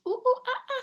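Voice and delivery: playful loud high monkey ooh ah; monkey call. Retold or imitated. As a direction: hoot like a monkey. Imitating monkey sounds.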